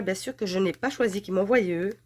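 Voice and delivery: ordinary talk, nothing marked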